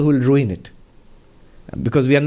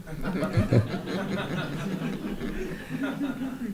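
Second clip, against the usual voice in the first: laughter; 7 semitones higher, 11 dB quieter than usual. Real, not acted. Laughs at own punchline.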